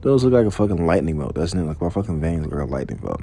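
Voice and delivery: ordinary talk, nothing marked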